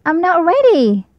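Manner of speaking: a little owl stalling; child owl voice. A narrator's reading. Baby Owl voiced in story.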